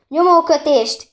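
loud, slow urgent shout. A narrator's reading. Dragomir shouting for a pressure bandage, loud and slow. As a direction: yell the order to stop the bleeding. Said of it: "kiabálta".

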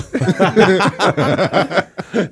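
big real burst of laughter, high and loud. Real, not acted. Laughing at moonwalking joke.

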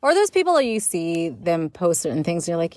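usual speaking voice

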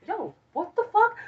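high, amazed yo what the fuck; mock surprise. Retelling her own shock to friends, high surprised squeal. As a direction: squeal it out in disbelief.

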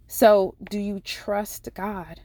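ordinary delivery

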